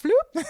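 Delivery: high, loud comic pop noise; mock pop sound. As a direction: squeak it out like a cartoon effect. Mask pulled off, Scooby-Doo.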